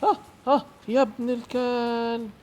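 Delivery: high gasps into a drawn-out curse; gasp, then wail the insult slowly; panicked cursing. Real, not acted. Player startled by clown attack. (شهقات رعب وشتيمة)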